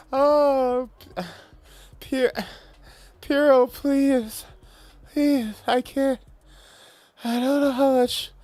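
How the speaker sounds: high, whimpering mock pleas, half-laughing; hammed-up pained moaning. Gamer pinned down by opponents, hammed-up high whimpering pleas. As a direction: ham up being overwhelmed, begging for mercy. Begging teammate, hammed-up moans.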